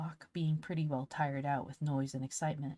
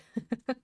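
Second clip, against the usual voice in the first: short real laugh; 7 semitones higher, 5 dB louder than usual. Real, not acted. Reader breaks off laughing.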